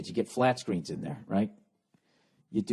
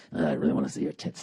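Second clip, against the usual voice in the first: much lower, mumbled creep voice; mumbling creep; 11 semitones lower than usual. Pretend. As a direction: mumble it like a creep on a dating site. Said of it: "you'd just be mumbling".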